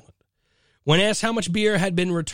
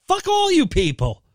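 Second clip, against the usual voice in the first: exasperated outburst; 14 semitones higher, 4 dB louder than usual. Real, not acted. Ranting at online ads.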